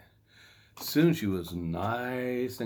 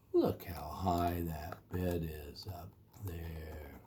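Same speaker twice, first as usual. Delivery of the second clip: hushed aside; 4 semitones lower, 7 dB quieter, slower than usual. A narrator's reading. Aside to listener.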